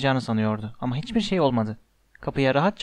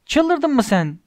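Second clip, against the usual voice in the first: high incredulous yell; 10 semitones higher, 4 dB louder, much slower than usual. A narrator's reading. Ron to Hermione over the missing wood, yelling. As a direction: cry out in disbelief. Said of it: "diye haykırdı Ron".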